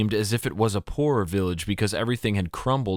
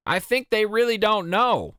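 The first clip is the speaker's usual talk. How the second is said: much higher, lighter woman in the crowd; woman's voice; 13 semitones higher than usual. A narrator's reading. Village woman telling the men the kids are truly clueless, high voiced. Woman in yellow gown speaks.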